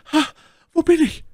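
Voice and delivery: very high, loud falsetto lady; a startled high-voiced call on coming round; My Lady crying out. A narrator's reading. My Lady waking from a faint, high loud cry. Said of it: "stieß einen Seufzer aus und rief dann".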